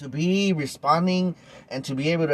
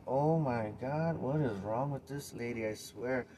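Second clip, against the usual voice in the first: muttered to self; 4 semitones lower, 12 dB quieter than usual. Retold or imitated. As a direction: mutter it as if only to yourself. Muttered inner reaction.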